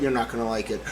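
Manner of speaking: ordinary talk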